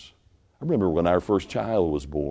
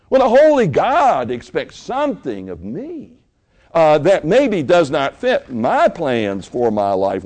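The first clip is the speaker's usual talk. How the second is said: rising, louder pulpit preaching; 7 semitones higher, 7 dB louder than usual. Acted. Pastor preaching to his church, loud and rising. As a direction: drive it home to the congregation.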